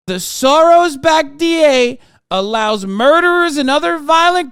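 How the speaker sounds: loud, high ranting read-out; Trump. Acted. Podcast host reading out Trump's grievance post, high loud rant. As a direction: declaim it high and loud, punching key words. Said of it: "so Trump says".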